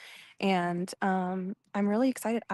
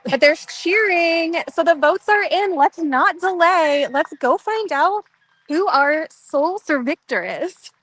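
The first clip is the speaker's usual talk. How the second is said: loud, high announcer call with stretched words; hyped announcement; 8 semitones higher, 10 dB louder than usual. Real, not acted. Host telling listeners the votes are in, hyped announcement. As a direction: belt it out over the cheering.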